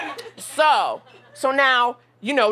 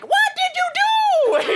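slow, far higher, loud yell; drunk shout; 3 dB louder, slower than usual. Retold or imitated. Drunk Sven yelling through the ambulance window, slow and shrieking high. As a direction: bellow it like a drunk friend at the window.

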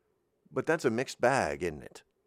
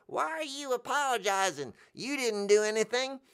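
puzzled questioner; 11 semitones higher than usual. Retold or imitated. Crowd member's puzzled question.

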